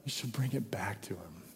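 soft, hushed answer; whisper. Acted. Preacher hushes God's answer to the congregation, whispering. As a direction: drop to a whisper for the punchline.

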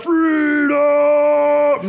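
high, long-held defiant yell; William Wallace battle yell. Retold or imitated. Preacher re-enacting a tortured hero's last defiant cry, drawn-out yell. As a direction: bellow it out, stretching the last vowel. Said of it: "He yells out".

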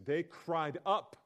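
they cried up drawn out slowly, loud and high; "They cried up" said loudly, slowly, rising at the end; slow dramatic read. Shown as a demonstration. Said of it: "it literally says".

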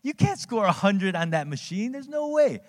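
put-on young son scoffing. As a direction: mimic an eight-year-old laughing it off. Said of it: "He's like".

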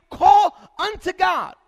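"Call unto God" belted out, drawn slow; slow shouted call; loud slow refrain. Acted. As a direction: hammer the refrain home. Refrain in preached list.

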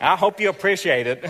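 high, loud mock reproach; playfully hammed-up guilt trip; grudging gift-giver. Preacher mocking a grudging giver, loud and aggrieved.

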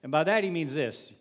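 normal talking voice

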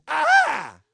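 high, loud mock scream; sudden loud "Ah!" in mock terror; imitated scream. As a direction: imitate a frightened passenger's shriek. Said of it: "the woman screamed".

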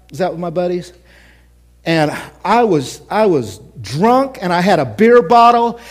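normal talking voice

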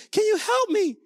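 put-on high little-kid voice; high-pitched childlike plea; pleading child. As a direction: mimic a child asking dad for help. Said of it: "they come up to me and say".

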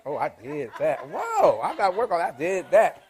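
a playful brag, laughing; laughing boast. Pretend. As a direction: show off with a laugh.

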